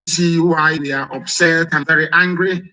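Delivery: ordinary talk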